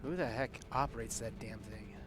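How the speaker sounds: ordinary talk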